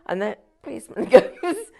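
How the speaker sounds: ordinary talk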